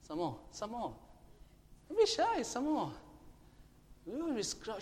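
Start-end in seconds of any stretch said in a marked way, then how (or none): 1.9-2.4 urging. Real, not acted. Coaxing congregation to shout louder.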